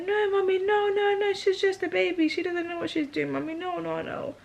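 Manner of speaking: put-on high-pitched little girl protesting; pleading child. Daughter protecting her baby sister, imitated high pleading. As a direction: imitate a child begging mommy, high and insistent. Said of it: "I'd be like".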